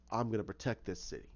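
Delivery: lightly voiced vigilante. Jason looking out from a motel, lightly voiced brooding vow. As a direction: mutter it with a hint of grim resolve. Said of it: "looking out and he says".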